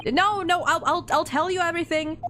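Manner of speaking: high, stammered acted panic; hammed-up frightened begging, pitched high; panicked pleading. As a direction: blurt it out to save yourself. Acted character pleading under threat.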